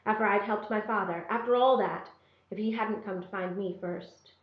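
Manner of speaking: ordinary delivery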